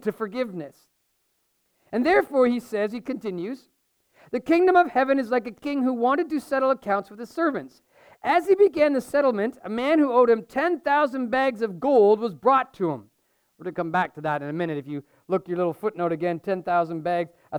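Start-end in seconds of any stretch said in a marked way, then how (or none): 4.3-13.1 measured reading of the parable; scripture reading. Acted. Pastor reading scripture to his church, measured and clear. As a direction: read it out to the congregation.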